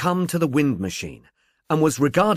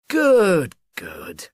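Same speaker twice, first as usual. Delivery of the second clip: expansive; 4 semitones higher, 4 dB louder than usual. A narrator's reading. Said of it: "said Humblebee expansively".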